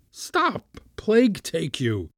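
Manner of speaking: high, exasperated cry without much volume; shouted. A narrator's reading. Uncle to the kitten backing away, mock shouting. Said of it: "he shouted".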